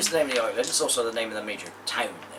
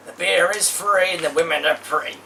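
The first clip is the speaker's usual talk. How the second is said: usual speaking voice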